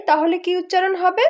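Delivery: ordinary talk, nothing marked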